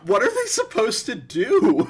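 loud, high, exasperated question. Real, not acted. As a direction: throw your hands up, fed up. Exasperated at the commenters.

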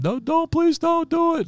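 very high-pitched imitated begging; desperate pleading. Retold plea to woman threatening suicide, desperate begging. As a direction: re-enact a panicked plea, voice shooting right up.

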